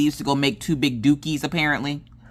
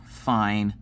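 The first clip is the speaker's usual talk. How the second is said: grudging; 5 semitones lower, 3 dB quieter than usual. Retold or imitated. Retold husband's sighing surrender.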